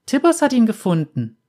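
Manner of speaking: put-on high, innocent child's answer; little girl voice. A narrator's reading. Girl tells the bounty hunter about her bear, high and innocent.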